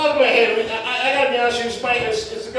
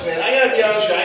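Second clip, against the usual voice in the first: usual speaking voice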